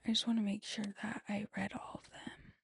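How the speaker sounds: near-whispered note to self; under her breath. Real, not acted. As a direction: whisper it absently while checking. Checking messages, talking to herself.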